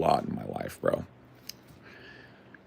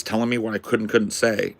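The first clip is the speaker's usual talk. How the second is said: ordinary talk, nothing marked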